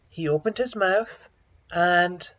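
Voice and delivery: ordinary delivery, nothing marked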